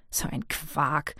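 whispered, slow contempt; scornful whisper. A narrator's reading. Sabinsen mutters about the minister, whispered scorn. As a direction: hiss it aside under the breath. Scornful aside mid-rant.

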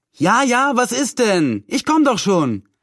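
high, hurried call from the kitchen, easing off at the end. A narrator's reading. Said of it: "rief ihre Tante".

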